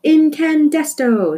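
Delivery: loud, slow, ringing announcement of the hero's name; proud shout. A narrator's reading. Flora proclaiming the hero's name to the squirrel, slow ringing shout. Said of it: "she was shouting the tiniest bit".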